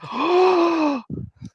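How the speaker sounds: mock gasp. Real, not acted. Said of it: "the big reveal".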